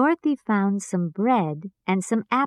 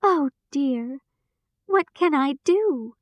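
tearful; 6 semitones higher than usual. Acted. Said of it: "Dorothy began to cry".

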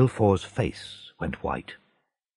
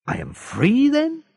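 happy. A narrator's reading. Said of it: "said Dantès happily".

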